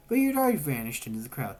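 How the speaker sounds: plain reading voice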